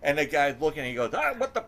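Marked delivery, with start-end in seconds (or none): 1.1-1.6 sudden high startled snap; high startled exclamation; startled outburst. Retold or imitated. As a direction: blurt it out, caught off guard. Angry worker at drive-thru.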